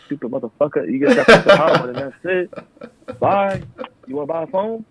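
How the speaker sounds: hammed-up annoyed clerk voice; mock fed-up clerk. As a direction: sneer it at a dumb customer. Mocking annoyed retail customer talk.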